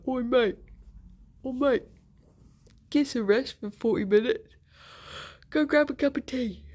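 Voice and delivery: mock Cockney heckle out the window; Cockney accent, pretend telling-off; put-on Cockney accent. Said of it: "Maybe don't put on the accent".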